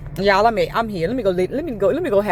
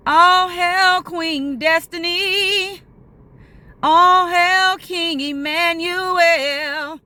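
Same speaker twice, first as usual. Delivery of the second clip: very slow, high, grand herald proclamation; royal welcome; 6 semitones higher, much slower than usual. Pretend. Staff greet the owners like royalty, very slow, high and grand. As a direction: announce them like royalty. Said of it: "it's almost like".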